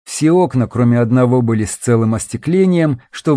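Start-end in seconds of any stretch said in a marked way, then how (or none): none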